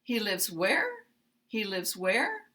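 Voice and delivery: He lives where rising on where; uptalk. Shown as a demonstration. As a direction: pitch up on where as a question. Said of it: "rising intonation".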